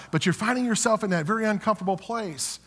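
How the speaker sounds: usual speaking voice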